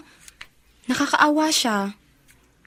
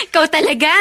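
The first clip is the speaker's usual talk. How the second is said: laughing, embarrassed; 4 semitones higher, faster than usual. Acted. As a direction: laugh it off while brushing her aside. Friend teases her about Carlo. (natatawang pagtanggi)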